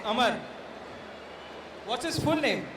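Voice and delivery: usual speaking voice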